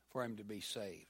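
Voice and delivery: ordinary talk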